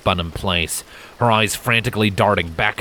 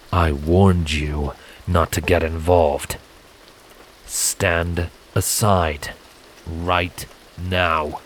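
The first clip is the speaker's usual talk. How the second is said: lower, slow warning; much slower than usual. A narrator's reading. Silent warning Scarlet to back off, low slow warning. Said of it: "in a low, warning tone".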